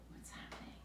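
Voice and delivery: hushed secret whisper. Real, not acted. Asking children a secret, whispered. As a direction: lean in and whisper it.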